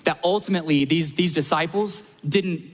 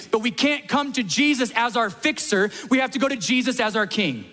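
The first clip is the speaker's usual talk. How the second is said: preacher driving home the point to the congregation, fervent; rising preaching; 4 semitones higher than usual. Acted. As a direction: build to a preaching climax, lifting each Eventually. Preaching climax of the sermon.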